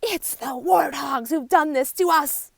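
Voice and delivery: high, loud, slow shouting; angry outcry. A narrator's reading. Animals waking to find markings swapped, shouting high and loud. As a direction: cry out in outrage. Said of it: "cry the other animals".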